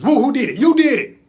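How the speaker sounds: loud, high hyped-up praise; rousing cheer. Acted. Uncle crediting nephew after the flip, cheering loudly. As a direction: cheer him on at full voice.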